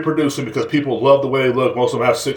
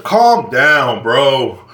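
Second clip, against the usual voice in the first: ordinary talk, nothing marked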